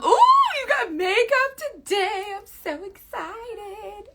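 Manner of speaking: high, slow, drawn-out gushing; mock excitement. Gushing over someone getting makeup today, mock excited.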